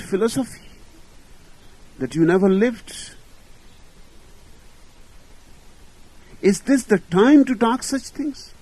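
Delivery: high, loud indignant outburst of a dying man; rising to a loud, high complaint; the dying man loudly protests being called unlived; retold angry protest. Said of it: "aflame with anger".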